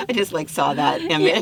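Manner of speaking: talking through a real laugh; can't stop giggling at the picture; amused laugh breaking into the words. Real, not acted. S1 laughs at the image.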